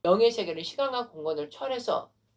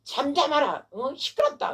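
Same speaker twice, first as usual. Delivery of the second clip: high, sharp mock rebuke; acted scolding; 8 semitones higher than usual. Crowd telling the beggar to hush, scolding. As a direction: imitate an annoyed crowd hushing someone. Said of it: "시끄럽다고 한 거예요".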